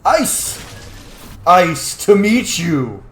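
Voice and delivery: loud, drawn-out pun delivery; hammed-up action hero shout; hammy one-liner. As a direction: belt out the cheesy battle pun.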